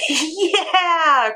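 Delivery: high, drawn-out cheer; delighted high-pitched whoop; jubilant cry. A narrator's reading. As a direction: cheer like a triumphant little parrot. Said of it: "cried the parrot".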